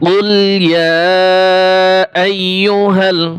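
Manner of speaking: slow melodic tajweed recitation; Quran recitation. Shown as a demonstration. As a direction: chant the verse slowly. (ধীর সুরেলা তিলাওয়াত)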